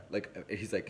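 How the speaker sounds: normal talking voice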